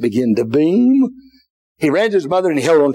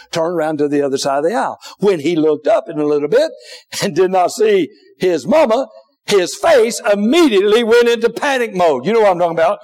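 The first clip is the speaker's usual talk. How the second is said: usual speaking voice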